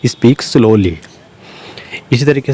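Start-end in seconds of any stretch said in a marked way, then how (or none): none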